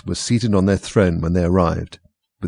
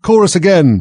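loud high call; shouted cue, high and loud; cried out; 10 semitones higher, 9 dB louder than usual. A narrator's reading. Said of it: "cried the Gryphon".

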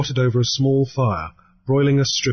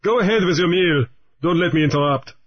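lightly voiced, cool pirate greeting; a hint of a pirate; 7 semitones higher, faster than usual. Raoul the pirate greets Grief, lightly voiced and cool. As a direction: greet him coolly, a bit higher.

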